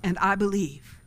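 led prayer. Acted. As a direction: pray it so they can repeat it. Leads sinner's prayer.

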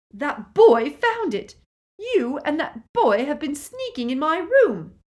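loud, high outburst; angry accusation. A narrator's reading. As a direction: accuse your sister at full voice. Petunia accuses her sister angrily.

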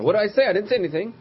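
high, loud mock-innocent protest; protesting innocence. Speaker acting a gossip defending himself after winking, protesting innocence.

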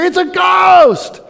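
shrieked high, loud and slow; terrified scream. Retold or imitated. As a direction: scream it like you've seen a ghost. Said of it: "scream out in fear".

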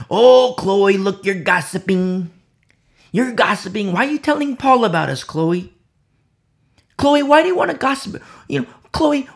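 high-pitched, indignant chiding; mock scolding. Church critics scolding Chloe for telling Paul, mock indignation. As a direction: act out busybody accusations with building exasperation.

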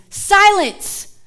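high, loud command; Jesus rebuking the waves; commanding rebuke. Retold or imitated. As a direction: order the storm to stop. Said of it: "he rebuked the wind".

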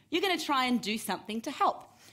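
normal talking voice

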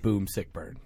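low, soft, unconvinced burn; very weak delivery. Real, not acted. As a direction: say the punchline very weakly, without conviction. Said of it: "Stated very weakly".

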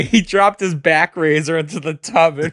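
giggling at your own morbid joke; laughing through words. Real, not acted. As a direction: crack up while telling it.

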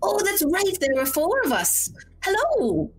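put-on old woman remembering, pitched high; sweet little grandmother recalls and greets; high granny voice. Old woman grabs the note back, high granny hello.

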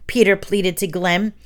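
usual speaking voice

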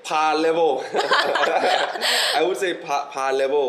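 loud, high, laughing hedge; cracking up while conceding the point; real laughing. Real, not acted. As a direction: answer through a burst of laughter. Laughing while answering overclaiming question.

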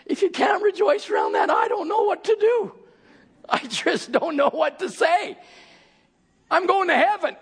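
high, shouted preaching; preaching at full force. Acted. Preacher urging the church to come, shouting. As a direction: whip the congregation up, shouting.

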